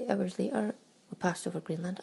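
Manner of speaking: usual speaking voice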